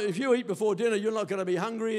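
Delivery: ordinary talk, nothing marked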